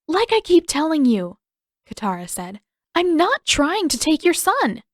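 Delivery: insist, frustrated and scared; exasperated plea. A narrator's reading. Katara exasperated under threat.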